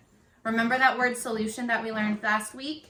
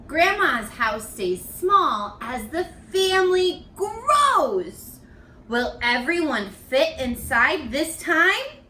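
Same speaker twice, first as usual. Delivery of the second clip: read-aloud storytelling. Acted. Teacher reads picture book aloud to her young students. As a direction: read it to children, savoring each line.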